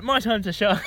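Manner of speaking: loud, high, gleeful boast; triumphant. Retold or imitated. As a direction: crow it out proudly. Showing off the flag, triumphant.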